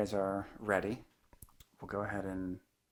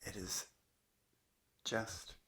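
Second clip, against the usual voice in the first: unhurried meditation teacher, long pauses between phrases; calm, measured guidance in short spaced phrases; guided meditation; 3 dB quieter than usual. Acted. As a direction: let each phrase settle into a long silence. Leads a guided meditation talk.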